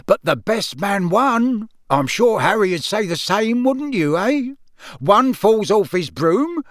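jovial proud father, high and unhurried; boastful Amos Diggory. Acted. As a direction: crow genially over your son's win. Voices Amos Diggory, bragging father.

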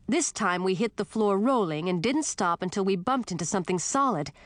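ordinary delivery

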